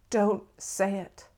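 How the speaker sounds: slow, tense warning, each word set apart; fierce, under the breath. A narrator's reading. Forrest staring at dying starfish, fiercely under his breath. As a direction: plead fiercely, word by word, held in. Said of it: "Forrest mumbles fiercely under his breath".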